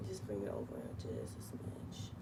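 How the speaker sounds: soft whisper to herself; whispered self-instruction while adjusting the design. Real, not acted. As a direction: breathe it out under your breath. Muttering to herself while working.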